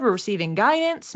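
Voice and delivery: ordinary delivery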